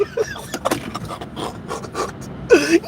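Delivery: soft wheezing laugh; breathy laugh. Real, not acted. Comic laughing at his fake name story. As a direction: crack up quietly.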